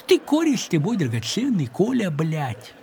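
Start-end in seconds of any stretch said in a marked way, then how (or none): none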